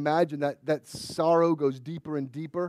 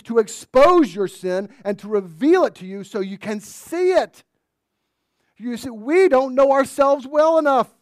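loud, rising preacher voice; full-force preaching; 9 semitones higher, 12 dB louder than usual. Acted. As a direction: drive the point home.

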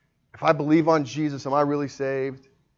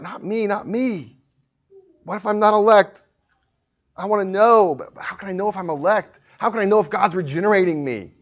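raised-pitch voice of an anxious questioner; doubting seeker; 7 semitones higher, 5 dB louder than usual. Acted. Preacher acting out a hearer's inner doubt, raised and pleading. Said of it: "maybe in your own heart".

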